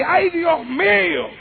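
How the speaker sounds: high, drawn-out cry of delight; amazed joy. Retold or imitated. Sister thanks God over the big cheque, overjoyed. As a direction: gasp it out slowly in wonder.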